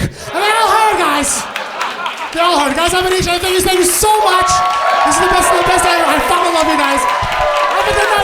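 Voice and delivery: high, loud shout over applause; excited sign-off. Real, not acted. Comic thanks the audience and says good night, shouting excitedly. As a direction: shout thanks to a roaring crowd.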